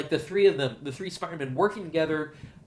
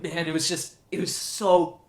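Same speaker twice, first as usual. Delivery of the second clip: usual speaking voice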